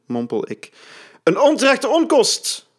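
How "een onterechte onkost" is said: high, loud bellow. A narrator's reading. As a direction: boom it out accusingly. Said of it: "buldert Antonissen".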